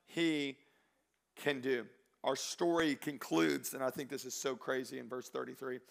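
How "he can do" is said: slow, loud, high preacher's exhortation; weighty, drawn-out sermon climax; emphatic preaching. Real, not acted. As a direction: drive each word home to the congregation. Preaches at full force.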